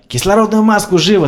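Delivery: high loud snapped order; command. A narrator's reading. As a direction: order the oxygen mask fast. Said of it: "скомандовал Слава".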